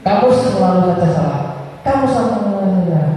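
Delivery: high-pitched, rapid accusing scold; punisher's scolding. Shown as a demonstration. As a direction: scold like a punishing teacher, pitch falling away.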